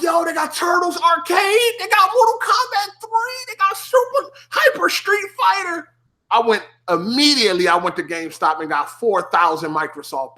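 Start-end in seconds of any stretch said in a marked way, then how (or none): 0.0-5.9 much higher, excited listing; thrilled gamer. Retold or imitated. Discovering the Xbox arcade, excited. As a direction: rattle off the games in delight. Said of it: "I'm like".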